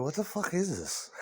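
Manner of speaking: a quiet puzzled dad asking about the record; a hint of a grumbling father; baffled father. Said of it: "That's what your father said".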